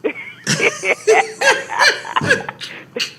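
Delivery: breathy high-pitched laughter. Real, not acted. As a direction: burst out laughing. Listener laughs at joke.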